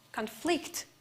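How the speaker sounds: "conflict" as a verb, con-FLICT; weak con, weight on FLICT in "conflict"; stress on last syllable. Shown as a demonstration. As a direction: land on the second syllable of "conflict". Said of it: "the last syllable is stressed".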